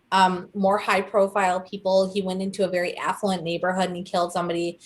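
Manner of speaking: ordinary talk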